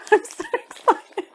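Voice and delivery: high giggly excited line; giggly excitement. Real, not acted. Opening the parcel, high giggly delight. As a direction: say it bursting with giggles.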